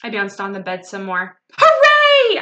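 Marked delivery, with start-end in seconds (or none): 1.5-2.4 very high, loud hooray; gleeful childish squeal; cheering. A narrator's reading. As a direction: cheer like an overjoyed little girl. Child cheering the supposed surprise.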